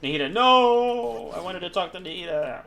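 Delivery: mock wail. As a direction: wail in protest, then sulk. Mock wail at losing Nahida scene.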